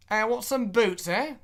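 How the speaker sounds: high-pitched, hammed-up British accent. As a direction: put on a high British shopkeeper's voice. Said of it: "when I try to do a British accent".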